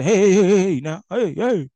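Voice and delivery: high, loud calls to a pulling dog; dog owner calling. Retold or imitated. Owner calling his pit bull to stop, high and loud. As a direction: bark out hey like a dog owner reining in. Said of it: "he'll say".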